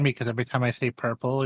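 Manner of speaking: ordinary talk, nothing marked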